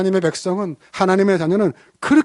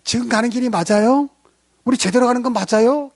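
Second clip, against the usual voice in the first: higher, worried repeated question; anxious asking; 6 semitones higher than usual. Retold or imitated. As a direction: nag like a nervous hiker. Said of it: "이렇게 묻는 거예요". (불안하게 묻는 여성 흉내)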